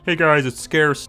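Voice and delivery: slow, put-on YouTuber hello; mock Scarce greeting. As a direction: drag out a parody channel intro. Mocking Scarce's YouTube intro.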